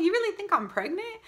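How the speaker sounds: asking the sister-in-law, shocked, in a high rush; stunned disbelief. Retold or imitated. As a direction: squeak it out in disbelief.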